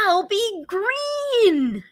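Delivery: high, slow kitten voice; little kitten voice. Acted. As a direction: sing it out slowly like a tiny kitten. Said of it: "said the next little fellow".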